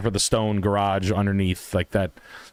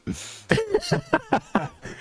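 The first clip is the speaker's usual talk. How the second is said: high squealing laugh. Real, not acted.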